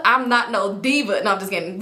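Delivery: ordinary talk, nothing marked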